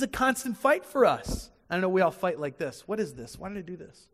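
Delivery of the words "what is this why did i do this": ordinary talk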